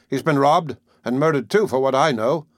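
ordinary delivery

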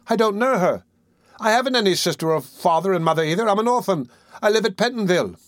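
put-on high-pitched, pleading boy; alarmed boy. A narrator's reading. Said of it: "greatly alarmed".

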